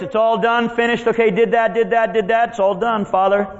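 high, sustained calling voice; loud cry. Acted. Jesus telling the Father it's all done, crying out. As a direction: call it out high, item after item. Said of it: "cry with a loud voice".

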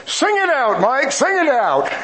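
high-pitched mock holler from the back; imitated dad's yell. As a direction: call out like dad cheering on his kid. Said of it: "and he yells out".

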